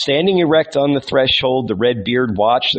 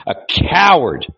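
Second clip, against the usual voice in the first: scornful repeat; 5 semitones higher, 3 dB louder than usual. A narrator's reading. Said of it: "he flung at him with scorn".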